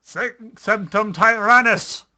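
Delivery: slow, loud, high spell cry; dramatic incantation. Acted. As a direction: bellow the spell slowly.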